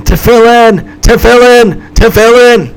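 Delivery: loud, high imitated scream; acted-out screaming, one cry after another. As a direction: belt out each repetition. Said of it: "He started screaming about his tefillin".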